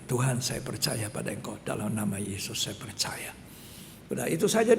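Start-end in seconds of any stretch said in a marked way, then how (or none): 0.1-3.3 soft, near-whispered prayer of faith; quiet prayer. Retold or imitated. Boy repeating a prayer after the pastor, hushed. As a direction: murmur the prayer.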